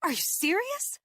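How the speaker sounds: high, disbelieving question; stunned indignant challenge; attacked woman demanding if he means it; incredulous. Acted. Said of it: "I said, incredulous".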